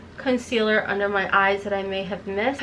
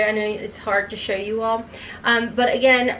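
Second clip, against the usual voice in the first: ordinary talk, nothing marked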